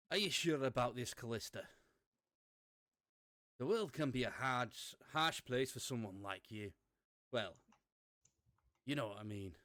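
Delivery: a deliberately awful Scottish brogue; bad Scottish accent. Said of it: "in my worst Scottish accent ever".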